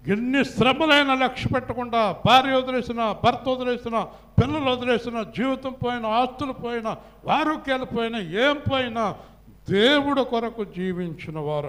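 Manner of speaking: high-pitched, driving pulpit list; full-force preaching. Real, not acted. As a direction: hammer out each item to the congregation. (హెచ్చు స్వరంతో ఉద్వేగంగా ప్రసంగం)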